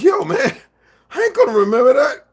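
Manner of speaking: very high, loud mock outcry; retold shouted protest. Retelling protest to friend about remembering, high comic shout. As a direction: yell it in hammed-up disbelief.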